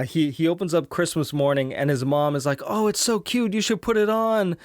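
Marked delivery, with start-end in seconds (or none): 2.7-4.6 lightly voiced gushing mom, pitched higher. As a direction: coo it like a delighted mom. Mom cooing over bunny suit.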